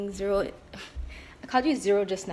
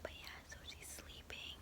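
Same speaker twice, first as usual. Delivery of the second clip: whispering; 17 dB quieter than usual. Real, not acted. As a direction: hush it, whisper every word. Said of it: "I'm currently whispering because".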